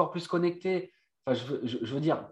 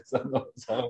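laughing; 8 semitones higher, 3 dB quieter, slower than usual. Real, not acted. Laughing over the remark.

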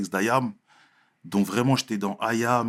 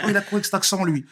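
light mock of kids sneering, pitched higher; mocking classmates; 9 semitones higher, faster than usual. Classmates mocking the Marseille boy, lightly imitated. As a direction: tease the new kid's accent. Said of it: "ça me parlait comme ça".

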